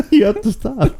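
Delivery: high, squeaky words through giggles; real laughing. Real, not acted. Co-host begging host to stop, laughing. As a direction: plead through helpless laughter.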